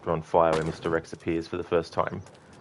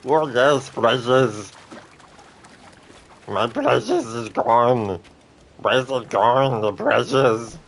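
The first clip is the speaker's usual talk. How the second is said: higher, louder creature voice, dragged slowly; Arnie Gollum; 5 semitones higher, 5 dB louder, slower than usual. Shown as a demonstration. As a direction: whine after the lost precious, then accuse. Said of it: "Arnie Gollum".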